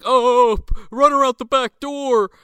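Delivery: high, loud alarm; hammed-up scramble to escape; mock panicked yell. Frat guys spotting the cops as Tiffany bails, mock panic.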